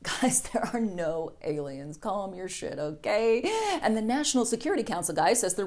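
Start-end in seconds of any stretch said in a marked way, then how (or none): 0.0-3.4 mock calming, soft.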